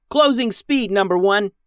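high, loud captain's order; young captain yelling orders; yelled command. A narrator's reading. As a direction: bark it across the bridge. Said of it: "yelled Max".

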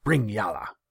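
sharp loud command; snapping. A narrator's reading. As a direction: snap the order out. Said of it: "he snapped".

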